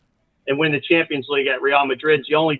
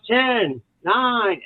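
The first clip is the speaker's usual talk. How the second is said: imitating a stadium countdown, pitched up; PA announcer; 6 semitones higher than usual. Announcer on the PA counting down for the team, mock announcer voice. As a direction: count down like the announcer, each number drawn out. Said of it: "I have him on the P A system. He goes".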